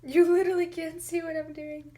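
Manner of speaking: high, loud, frustrated outburst; exasperated loud cry. Real, not acted. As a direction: yell it out in frustration. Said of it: "repeated in an exasperated, loud cry".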